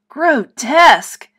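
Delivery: high, loud, slow "Grotesque!"; teacher shouting praise; delighted shout. Acted. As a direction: cry out in delight. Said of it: "shouted Miss Wart".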